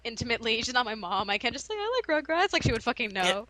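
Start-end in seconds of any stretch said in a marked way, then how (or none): 1.8-2.5 high-pitched mock childish blurt; like a five-year-old. Student re-enacting her blurt to classmates, like a five-year-old. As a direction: pitch it up like a kid announcing a favorite. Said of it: "I said it like I was a five-year-old".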